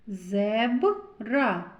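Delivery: зебра broken after a marked b, then ra; isolated b sound. Shown as a demonstration. Teacher picking out a consonant, marked b in зебра. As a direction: lean on the b in зебра, zeb-ra. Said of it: "Б твердий приголосний".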